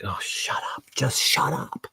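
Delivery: hushed, whispery plea to stop; exasperated whisper. Acted. Fed-up parent answering kids' slang, exasperated whisper. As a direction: hiss it out under the breath, fed up.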